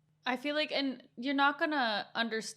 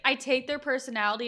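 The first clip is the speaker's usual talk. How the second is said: ordinary talk, nothing marked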